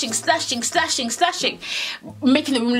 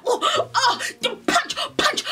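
high, laughing mock cheer at a punch; mock fight cheer; 13 semitones higher, slower than usual. Imitating onlookers hyped at the fight. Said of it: "They were like".

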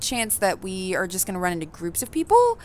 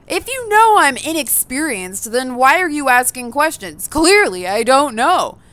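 much higher and louder, slow at the start; real exasperated outburst; 6 semitones higher, 7 dB louder, slower than usual. Real, not acted. Player rants at the game for asking what they can't know. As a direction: throw up your hands at the question.

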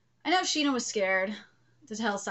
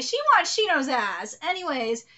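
normal talking voice

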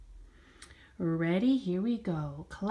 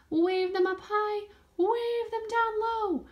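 high; 12 semitones higher than usual. Pretend. Teacher cues arms up high.

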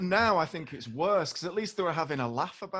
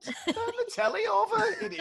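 much higher laughing jeer; mock telly heckle. Guest mocks a viewer yelling at the girl band, laughing high.

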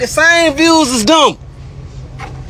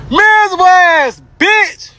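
shouted insult; 7 semitones higher than usual. Real, not acted.

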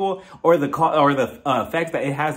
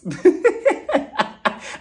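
real laugh, much higher; high laughing fit; 13 semitones higher than usual. Real, not acted. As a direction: crack up in a high giggle. Laughing at Sergio line.

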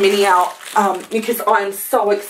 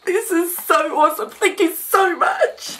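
high, squeaky tearful gratitude; happy crying; 9 semitones higher than usual. Real, not acted. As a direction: thank her through real happy tears. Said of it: "wasn't gonna cry on camera".

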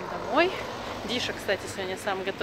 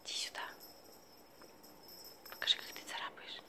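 whispering to cat; 19 dB quieter than usual. Real, not acted. Coaxing the cat softly.

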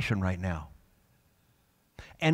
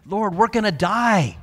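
higher, louder desperate wail; panicked cry; 7 semitones higher, 6 dB louder than usual. Retold or imitated. Disciples freaking out in the storm, high loud wail. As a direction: cry out to the Lord in terror. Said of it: "No, they're freaking out".

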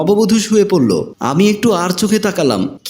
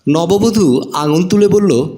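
usual speaking voice

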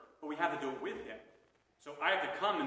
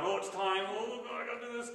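mock dread; 9 semitones higher, 5 dB louder than usual. Penitent dreading confession.

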